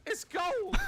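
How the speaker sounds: laughing. Real, not acted. Laughing at the clip.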